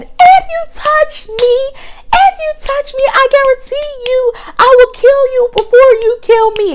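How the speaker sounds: very high, slow hammed-up warning; mock threat. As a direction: warn him off, retold. Her threat back, retold.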